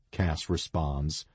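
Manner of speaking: ordinary delivery, nothing marked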